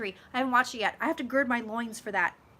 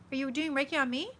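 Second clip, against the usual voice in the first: usual speaking voice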